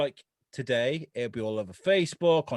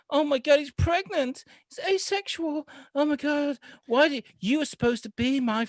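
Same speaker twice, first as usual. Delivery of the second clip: whiny Broderick impression; 14 semitones higher than usual. Podcaster mocks the actor's whiny lead, much higher whining. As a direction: whine like a helpless nerd. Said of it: "He talks like this".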